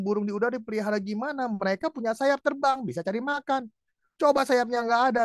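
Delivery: high-pitched retold rebuttal; arguing with God. Man disputing the verse about fed birds, argumentative. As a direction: push back in a lifted, disputing voice. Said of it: "berargumentasi pada waktu baca firman".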